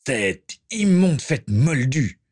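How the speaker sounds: slow disgusted sneer; disgusted grimace. Acted. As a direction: spit it out with revulsion. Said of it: "grimaça Dolohov".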